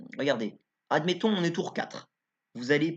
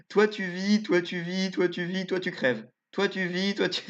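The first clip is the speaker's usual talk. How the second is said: meme quote; 6 semitones higher than usual. Acted. Quoting a famous meme to viewers, hammed-up verdict voice. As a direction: point at each one and pronounce their fate, hammed-up. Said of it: "Si vous avez la référence".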